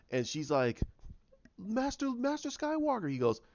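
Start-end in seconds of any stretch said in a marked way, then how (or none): 1.6-3.1 high mock female voice; imploring heroine. Rey calling to Master Skywalker, high imploring imitation. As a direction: imitate a woman appealing, pitched way up.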